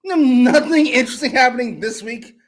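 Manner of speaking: loud, high-pitched exasperation; annoyed complaint. Real, not acted. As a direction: complain loudly, pitch pushed up. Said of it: "I'm so fucking annoyed".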